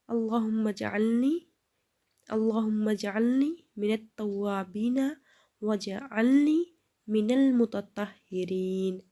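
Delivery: slow recitation with the words flowing together; joined recitation. Shown as a demonstration. Teacher reciting the dua again with the words joined. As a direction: join the words into one smooth phrase. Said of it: "پھر سے ایک بار پڑھیے".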